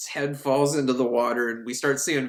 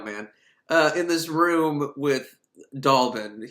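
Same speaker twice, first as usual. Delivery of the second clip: normal talking voice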